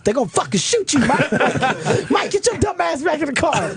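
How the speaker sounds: high-pitched mock panic; urgent, high imitated yell without real volume; alarmed warning. Passenger warns friend walking to cop.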